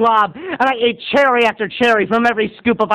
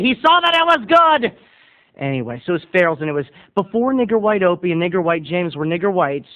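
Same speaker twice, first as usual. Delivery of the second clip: usual speaking voice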